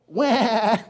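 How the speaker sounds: high, hammed-up toddler wail; mock baby wail. Believer throwing a tantrum at God, mock wail. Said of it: "you lay on the floor".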